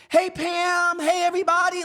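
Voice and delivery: high, bright hello to the office; cheerful greeting. Retold or imitated. Teacher walking into the office greets everyone, high cheerful hello. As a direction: chirp it like nothing happened. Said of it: "just like it was a normal day".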